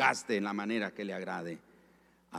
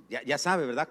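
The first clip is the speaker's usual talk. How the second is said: normal talking voice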